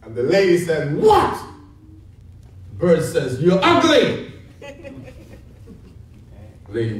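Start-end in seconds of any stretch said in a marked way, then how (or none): 1.0-1.3 very high, rising "what"; sharp questioning reply. Acted. Lady called by the parrot next morning, sharp high question. 3.4-4.3 high parrot insult; lightly voiced bird taunt, pitched up. Parrot insulting the lady next morning, high taunt.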